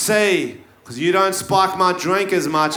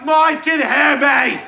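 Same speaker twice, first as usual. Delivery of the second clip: much higher, loud mock yell; 9 semitones higher, 3 dB louder than usual. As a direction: bellow it over the noise. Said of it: "I'm yelling because".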